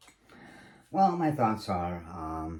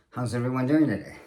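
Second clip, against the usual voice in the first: polished greeting, higher and louder; proper phrasing demo; 5 semitones higher, 7 dB louder, faster than usual. Shown as a demonstration. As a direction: say it cleanly, like a host addressing a room. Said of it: "you would be better if you said".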